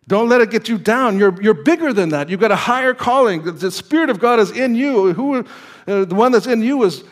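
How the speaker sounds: high, forceful sermon delivery; impassioned preaching. Real, not acted. Exhorts the congregation.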